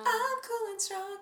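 mock boast. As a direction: squeak out a silly brag. Mock boast, high and slow.

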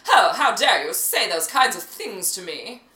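put-on loud, dramatic transatlantic accent; indignant old-film-star transatlantic voice; Katharine Hepburn, transatlantic. Showing a co-host the transatlantic accent, posh and dramatic. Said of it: "how Katharine Hepburn talked in movies".

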